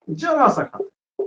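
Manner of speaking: high, delicate, as if nibbling; dainty eater voice. Acted. Teacher mimics a light eater for the class, high and dainty. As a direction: squeak it out like a picky eater.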